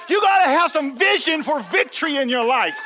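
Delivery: loud, high preacher's call; fired-up sermon voice; preaching. Acted. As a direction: preach it out to the church. Preacher opening at full force.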